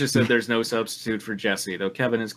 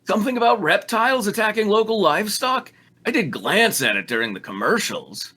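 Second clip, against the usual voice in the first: raised voice, higher at first; mildly shouted; 9 semitones higher than usual. Acted. Damien shouting back about the mission report, mild shout. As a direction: call it out defensively. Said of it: "Damien shouted".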